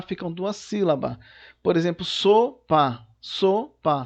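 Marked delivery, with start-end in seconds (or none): 2.0-3.1 "sopa" split as so, pa; syllable by syllable. Shown as a demonstration. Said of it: "quando você separar". 3.2-4.1 "Sopa" split as so, pa; syllable by syllable. Shown as a demonstration. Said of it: "São duas sílabas".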